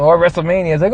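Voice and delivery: ordinary talk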